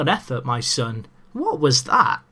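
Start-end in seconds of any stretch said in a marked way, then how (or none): 1.3-2.2 amazed exclamation. Real, not acted. Marvelling at the strike.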